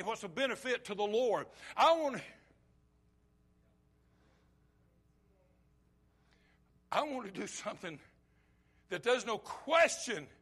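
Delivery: loud, high-pitched sermon delivery; full-force preaching. Real, not acted. Preacher urging the congregation to follow God's call, full-force preaching. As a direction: preach it hard, driving each phrase out loud.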